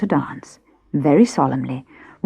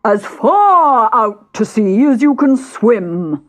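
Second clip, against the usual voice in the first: loud, slow, higher eager voice; excited; 7 semitones higher, 6 dB louder, much slower than usual. Acted. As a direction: say it like it's thrilling. Mock Turtle capering wildly.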